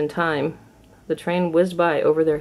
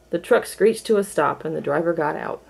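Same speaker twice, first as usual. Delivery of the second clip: usual speaking voice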